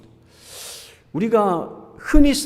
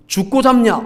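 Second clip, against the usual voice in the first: imitating a Jeolla tough, higher and louder; mock Jeolla menace, pitched up; a lightly played Jeolla threat, raised higher and louder; Jeolla dialect threat; 6 semitones higher, 4 dB louder than usual. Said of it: "전라도에도 있어요".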